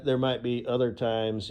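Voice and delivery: ordinary delivery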